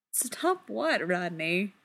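ordinary delivery, nothing marked

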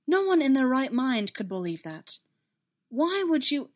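insist, voice lifted; heated reassurance; insistent protest. Acted.

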